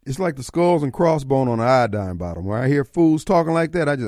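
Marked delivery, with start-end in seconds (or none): none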